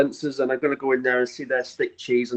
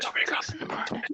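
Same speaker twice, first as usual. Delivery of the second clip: whispering; 11 dB quieter, much faster than usual. Real, not acted. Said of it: "are we whispering".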